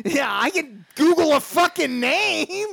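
high, loud, slow mocking disbelief; incredulous retort. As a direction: ridicule his excuse.

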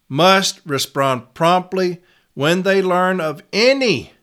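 slow, with "Must" and "any" leaned on; stress on key words. Shown as a demonstration. Reading policy to reps, stressing "Must" and "any" slowly. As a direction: set off "Must", "promptly" and a high, drawn-out "any". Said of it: "several words that you can highlight".

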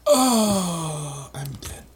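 hammy school-play death, fading out; mock dying line, trailing off; overacted death scene. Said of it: "trying to act out a death scene in a school play".

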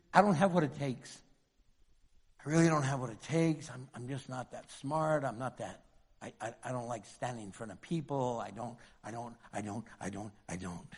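small, fading, unsure mumble; timid excuse voice. Retold or imitated. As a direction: shrink away, voice dying out. Voicing timid excuse maker.

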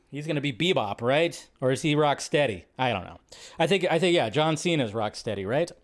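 ordinary delivery

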